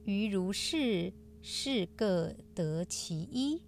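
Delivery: slow, measured scripture reading; sutra recitation. Acted. Host reciting today's sutra passage to listeners, solemn recitation.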